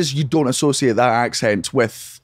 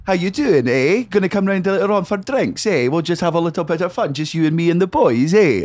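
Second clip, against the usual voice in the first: higher, lilting Edinburgh accent; chatty Edinburgh voice, each eh a question; 5 semitones higher than usual. Shown as a demonstration. Friend demonstrating the horrible Edinburgh accent, higher lilting voice. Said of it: "What is the Edinburgh accent?".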